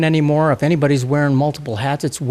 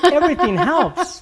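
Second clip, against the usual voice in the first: laughing; 12 semitones higher than usual. Real, not acted. Host laughs at the joke.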